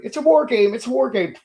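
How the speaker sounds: high-pitched mock insistent chant; mock insistence. YouTuber mimicking rivals who argued unopposed, high mock insistence.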